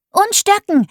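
very high gleeful shout; excited cry. A narrator's reading. Child joining his sister's game, very high excited cry. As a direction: chime in, thrilled. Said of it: "rief ich".

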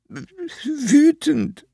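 high-pitched, stammered sob; sobbing. A narrator's reading. Hedgehog answering the fire toad's question, tearful sobbing. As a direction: choke it out high and loud through tears. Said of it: "schluchzte der Igel".

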